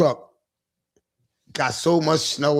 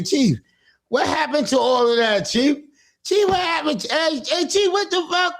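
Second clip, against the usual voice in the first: high mocking voice calling Chief; imitating people razzing Chief; mock teasing; 8 semitones higher than usual. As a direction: squeal it like teasing friends.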